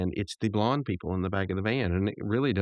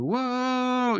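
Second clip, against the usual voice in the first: high, drawn-out whoa; mock a long cartoon whoop; imitated "whoa" cheer. Mordecai finding the joke funny, high mock whoop. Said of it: "he pulls the, uh, the".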